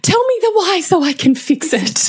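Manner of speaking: high squeaky mock whine, laughing through it; mocking whiny voice. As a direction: parody a needy parent, way up high. Mocking a demanding parent.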